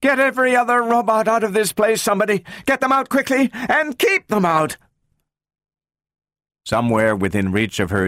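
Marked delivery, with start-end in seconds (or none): 0.0-4.8 high, urgent shouted orders; rapid high-pitched alarm; shouting at full voice. A narrator's reading. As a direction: yell for help without pausing for breath. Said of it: "shouted at the top of her voice".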